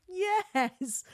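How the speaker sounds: sudden high yelp of joy; excited cheer. Real, not acted. Fan cheering Vinny's hookup, high excited yelp. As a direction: cheer like your team just scored.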